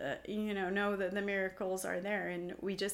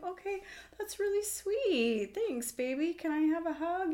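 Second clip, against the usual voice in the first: put-on higher, sweet and fond, humoring a little kid; sweet mom voice; 9 semitones higher, 3 dB louder than usual. Mom thanking her little daughter for a sweet thought, high and sweet. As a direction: thank the child gently like a doting mom.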